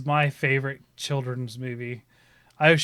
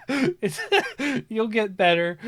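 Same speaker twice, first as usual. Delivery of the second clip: usual speaking voice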